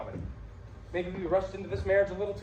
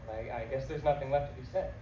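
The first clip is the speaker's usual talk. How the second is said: low, soft and halting; defeated, lowered voice; subdued resignation; 9 semitones lower, 7 dB quieter than usual. Acted. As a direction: give in, stumbling on the first word. Husband accepts the divorce.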